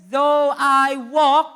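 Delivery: "walk" loud, high and drawn out; stress on walk. Shown as a demonstration. Preacher quotes the psalm to the church, heavy stress on "walk". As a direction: stretch "though I walk" slowly, landing hard on "walk". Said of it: "I want you to emphasize this word".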